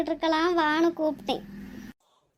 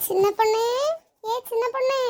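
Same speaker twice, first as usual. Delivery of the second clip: higher-pitched summoning call; 4 semitones higher than usual. Acted. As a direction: call her over by name, pitched up. Calling out to daughter-in-law.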